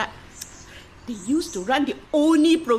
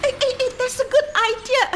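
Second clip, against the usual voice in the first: teasing excitement; 9 semitones higher, 3 dB louder, faster than usual. Acted. Joking about becoming dancing girl.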